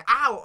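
sharp high pretend ow; mock yelp of pain. Anchor pretends a bee stung him, high yelp.

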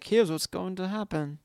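slow, drawn-out monotone. Retold or imitated. Host mocking how Bran answers people, monotone. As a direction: intone it like a droning oracle. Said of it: "In a monotone voice".

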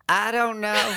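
high, drawn-out dumb denial; Southern playing dumb. Mocking a Southern suspect playing dumb. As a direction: act clueless in a put-on voice. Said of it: "the Southern playing dumb".

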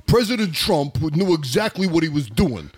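a hint of Russian mixed with Goodfellas New York; lightly voiced Lev Parnas, Russian-New York accent; mild Russian Mafia accent. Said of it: "there's my accent for you".

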